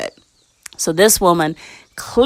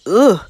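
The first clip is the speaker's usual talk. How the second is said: disgusted ugh; 3 semitones higher, 4 dB louder than usual. Retold or imitated. Recoiling from cursed food.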